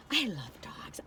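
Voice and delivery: breathy whispered confession. Real, not acted. Telling her viewers she loves dogs, whispered. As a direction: whisper it fondly. Tender aside.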